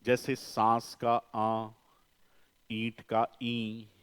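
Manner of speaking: "सांस का आ, ईंट का ई" said slowly with nasalized vowels; nasal vowel demo. Shown as a demonstration. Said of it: "Hindi में है".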